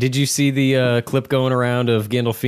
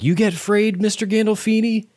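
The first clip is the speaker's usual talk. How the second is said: put-on little girl voice; 10 semitones higher than usual. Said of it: "And they're like".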